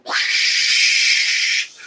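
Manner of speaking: breathy whoosh of wires dragging on the road; slow, long unvoiced whoosh; drawn-out rushing-air noise; imitated whoosh. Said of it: "it's going".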